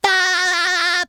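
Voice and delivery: high, held cartoon "aah"; drawn-out cry. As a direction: let out one long, high note after the joke. Cartoon cry after a silly pun.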